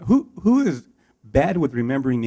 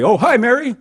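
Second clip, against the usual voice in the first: much higher and louder, overexcited; loud mismatched greeting; 11 semitones higher, 8 dB louder than usual. Shown as a demonstration. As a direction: blast the greeting back, pitched way up. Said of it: "such a drastic difference".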